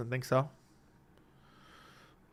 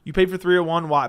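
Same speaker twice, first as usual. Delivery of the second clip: usual speaking voice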